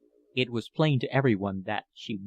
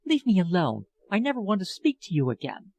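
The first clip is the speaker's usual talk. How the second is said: higher, tearful pleading woman's voice; pleading older lover; 6 semitones higher than usual. A narrator's reading. Older woman begs departing young lover, higher and pleading. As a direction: beg him not to leave, voice lifted and aching. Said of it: "she weeps on her bed".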